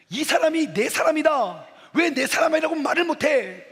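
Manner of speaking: loud, high impassioned outcry; hammed-up declaration from a TV drama; drama hero. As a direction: quote the old drama line with mock melodrama. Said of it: "드라마 주제인데 옛날 건데".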